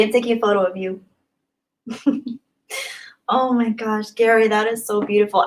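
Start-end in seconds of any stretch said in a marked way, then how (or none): none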